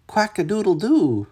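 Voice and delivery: high, loud mock rooster crow; playful quacking crow; crowing duck. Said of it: "cried Duck".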